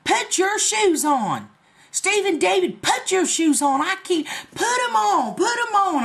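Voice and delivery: high hammed-up grandmother scolding; mock hollering grandma. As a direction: imitate an exasperated grandma yelling at the boy. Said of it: "heard her hollering".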